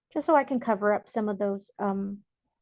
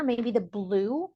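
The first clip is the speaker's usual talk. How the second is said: ordinary talk, nothing marked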